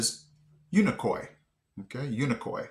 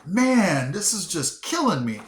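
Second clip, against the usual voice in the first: mock exasperation; 6 semitones higher, 3 dB louder than usual. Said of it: "And I'm like".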